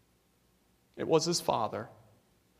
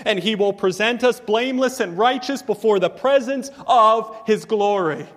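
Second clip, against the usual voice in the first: louder, ringing, rising exhortation; full-force preaching; 5 semitones higher, 6 dB louder than usual. Acted. Pastor urging the congregation to take heart, fervent and loud. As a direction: lift the church with fervor.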